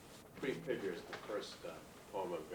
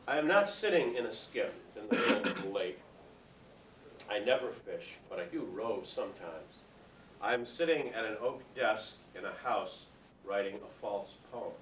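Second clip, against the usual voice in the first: louder opening on each phrase, settling at its end; poetry reading; 3 semitones higher, 7 dB louder than usual. Acted. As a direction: read your poem to a hushed audience, projected and unhurried. Poet reads his poem aloud.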